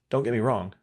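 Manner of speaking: normal talking voice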